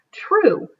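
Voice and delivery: usual speaking voice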